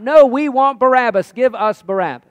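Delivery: loud, high mob demand; crowd crying out. Acted. Crowd refuses Pilate's offer to release Jesus, crying out. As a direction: shout it as an insistent crowd. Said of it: "And then they cry out".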